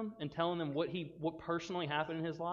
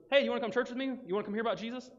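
higher, acted perky invite; eager invitation; 7 semitones higher, 4 dB louder, faster than usual. Someone inviting a friend to church, acted eagerness. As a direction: invite them along eagerly.

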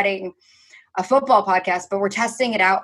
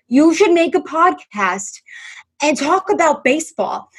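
retold friend's pitch; 6 semitones higher, slower than usual. Friend pitches his big idea.

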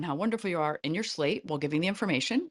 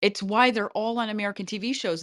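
ordinary talk, nothing marked

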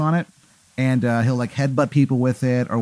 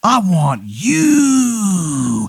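high, very drawn-out wrestler call; the Menace's challenge; 6 semitones higher, much slower than usual. Acted. Wrestler points at the heckler, howling a slow challenge. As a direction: stretch the last word into a long howl. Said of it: "like an amalgamation of Ric Flair".

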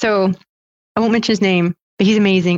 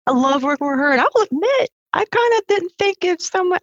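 lightly imitated Western Maryland accent, pitched much higher; hint of a Western Maryland colleague's twang, higher; 12 semitones higher than usual. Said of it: "I can't do the accent well".

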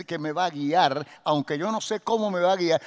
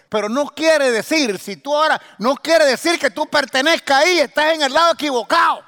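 rising higher and louder to a shout; building preaching; 7 semitones higher, 9 dB louder than usual. Real, not acted. Preacher urging listeners they don't belong there, building to a shout. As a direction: drive the point home, climbing to a shout.

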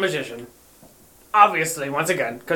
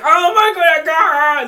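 high, loud mock wail; exaggerated high-pitched crying; 9 semitones higher, 4 dB louder, slower than usual. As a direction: act out a bawling fit. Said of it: "it only happens when I'm crying".

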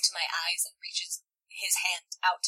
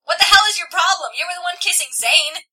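loud, high angry accusation; raised, indignant shouting; acted yell; 8 semitones higher, 8 dB louder than usual. A narrator's reading. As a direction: yell back in a heated quarrel. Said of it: "he yells".

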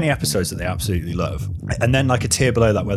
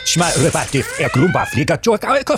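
gibberish alien voice; 14 semitones higher, 6 dB louder than usual. Acted. Gibberish mock alien language.

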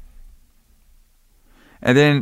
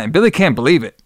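ordinary talk

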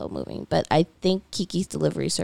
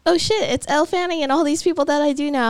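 surprised; 9 semitones higher, 3 dB louder than usual. Retold or imitated. Quoting own surprised realization.

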